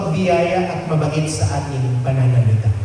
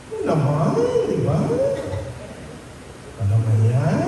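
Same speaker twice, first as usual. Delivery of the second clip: soft but snide; faster than usual. Shown as a demonstration. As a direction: sneer it under your breath. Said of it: "Minsan kahit mahina, may tono".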